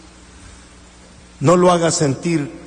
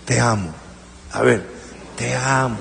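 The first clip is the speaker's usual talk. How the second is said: suave; 7 semitones lower, 6 dB quieter than usual. Shown as a demonstration. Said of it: "Dígale al que está al lado".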